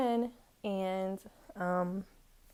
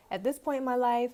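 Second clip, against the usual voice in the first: ordinary talk, nothing marked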